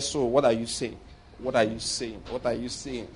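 low, hushed repeated muttering; under the breath. Retold or imitated. Church member questioning the pastor, whispering under his breath. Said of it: "he was just whispering under his breath".

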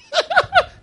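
a squeal of real laughter; can't stop laughing; high burst of laughter. Real, not acted. Pastor cracking up at his own story, high laugh. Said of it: "Let me finish laughing".

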